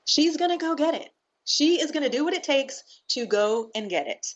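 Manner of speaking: passionate. Real, not acted. Guest to host, proudly building her case. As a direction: pitch climbing high as conviction builds.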